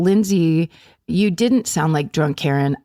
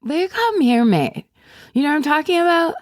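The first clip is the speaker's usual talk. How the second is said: tipsy pushy voice; 9 semitones higher, faster than usual. Retold or imitated. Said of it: "And said".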